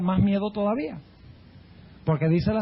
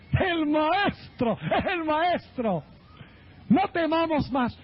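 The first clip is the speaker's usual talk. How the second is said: relieved cry; 7 semitones higher, slower than usual. Retold or imitated. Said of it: "Enseguida San Pedro dice".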